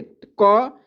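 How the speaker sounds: slow, drawn-out "क"; slow demo. Shown as a demonstration. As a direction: stretch the sound "क" slowly for the class. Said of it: "बारह खड़ी लिख रहे हैं". ("क" को धीरे खींचकर बोलना)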